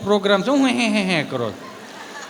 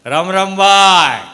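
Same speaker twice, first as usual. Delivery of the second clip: put-on much louder, slow old man's hail; loud slow greeting; 9 dB louder, much slower than usual. Old man greeting shopkeeper.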